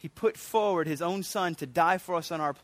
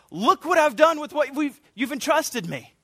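higher, louder, eager report; giddy servant; 8 semitones higher, 4 dB louder than usual. Retold or imitated. Servant coming before the Father, giddy and eager. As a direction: show off your work with glee. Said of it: "like this giddy little servant".

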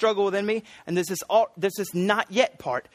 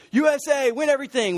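mock patriotic cheer; 7 semitones higher than usual. Preacher imitating proud Olympic fans, mock cheering. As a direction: cheer like a fan at the Olympics, playfully. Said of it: "we're all like".

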